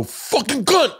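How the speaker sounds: high, hammed-up curse at a missed swing; tossing off an imitated swear; lightly voiced frustrated batter; mock angry curse. Retelling Ernst cursing at softball.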